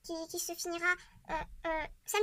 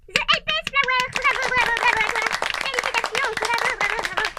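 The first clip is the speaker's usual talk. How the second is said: much higher and louder, cheering in a put-on voice; ecstatic cheer; 5 semitones higher, 13 dB louder than usual. Crowning the grand winner, ecstatic loud cheering. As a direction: shout the winner like a gameshow host.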